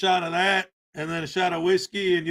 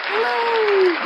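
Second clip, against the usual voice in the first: falsetto hello; 14 semitones higher, 4 dB louder than usual. Acted. Greeting the stream.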